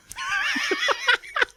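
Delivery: shrieking burst of laughter. Real, not acted. Laughs at own impression.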